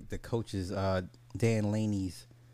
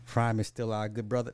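normal talking voice

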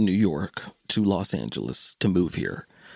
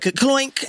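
imitated crash noise.

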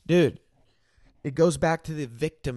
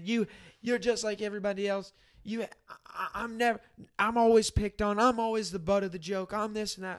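higher, softer mock-tearful complaint; imitated crying; 5 semitones higher, 3 dB quieter than usual. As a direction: play a tearful victim's protest, lightly. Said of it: "he starts crying".

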